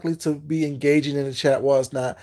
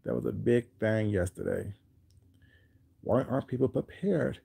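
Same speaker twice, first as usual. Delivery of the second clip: ordinary talk, nothing marked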